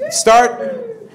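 a sudden very high crowing call; rooster crow. Shown as a demonstration. Teacher leads stretching class in a rooster crow.

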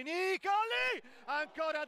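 sudden high shout of a chance; shouting commentary. Real, not acted. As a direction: yell it as the ball flies. Near goal, commentator cries out.

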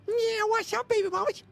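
squeaky chipmunk pitch; helium voice. Pretend. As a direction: squeak it up really high. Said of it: "Oh my God, my voice".

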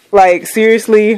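normal talking voice

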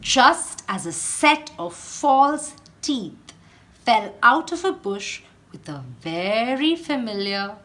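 plain reading voice

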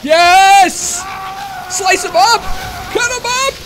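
high, loud battle cheers; excited cheering. Real, not acted. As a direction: egg your fighter on. Urges his character on in the fight.

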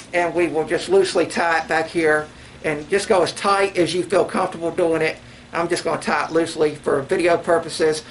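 muffled by cloth over mouth; speaking behind a face covering; talking through a bandana. Real, not acted. Seen 2: Bandana covers nose and mouth throughout; tied on from 0.6.

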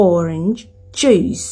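"orange juice" slowly, word by word; "orange juice" with both final consonants sounded; English orange juice. Shown as a demonstration. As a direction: say "orange juice" as OR-inj, then JOOS. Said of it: "น้ำส้มก็บ่แม่น".

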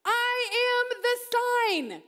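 preacher voicing Jesus, thrilled, high and loud; excited declaration. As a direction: announce it with thrill.

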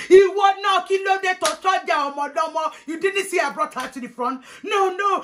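high-pitched, loud opening outburst; outraged auntie. Retold or imitated. Auntie rounds on someone at the insult, high outraged scolding.

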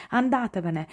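plain reading voice

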